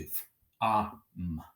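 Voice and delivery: "F-, ah, m" as three separate sounds; "F-, ah, m" phoneme by phoneme; sounding out. Shown as a demonstration. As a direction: say each sound of "F-, ah, m" alone, with gaps. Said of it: "sound out as we need to".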